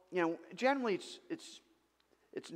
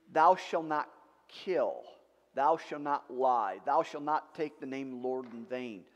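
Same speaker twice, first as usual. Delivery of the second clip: solemn recital; 3 semitones lower, 4 dB louder than usual. Acted. Preacher reciting the commandments.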